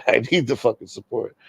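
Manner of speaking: laughing through the repeated plea; amused, half-joking plea. Real, not acted. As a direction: laugh at your own begging. Repeats plea, second time laughing.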